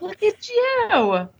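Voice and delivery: slow, sing-song pretend admiration; mock-proud tease. Teasing a co-host who read a book, mock-proud and stretched.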